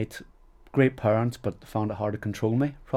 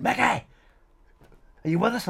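retold call across the stage, pitched high and loud; 13 semitones higher, 5 dB louder than usual. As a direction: shout it over the band to a bandmate. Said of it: "Frankie turning around going".

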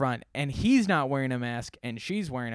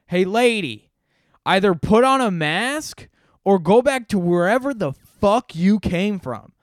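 high, loud mock tirade; acted-out fed-up bellow; imitated angry shout; 5 semitones higher, 10 dB louder than usual. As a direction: yell it like an irate bystander. Angry man in line confronts customer.

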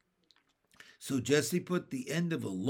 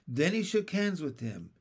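ordinary delivery, nothing marked

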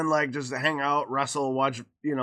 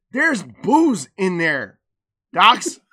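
slow, loud, emphatic scolding; exasperated mom imitation; 2 semitones higher, 8 dB louder, much slower than usual. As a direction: spell it out like a fed-up mother. Mom explains angrily to child.

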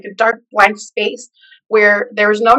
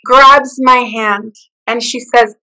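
ordinary delivery

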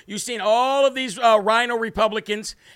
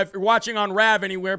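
normal talking voice

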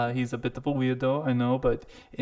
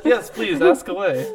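giggling, invitation half lost in laughter; laughing through it; 8 semitones higher, 7 dB louder than usual. Acted. NPC cracking up while inviting the question. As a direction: laugh as you say it, higher and louder.

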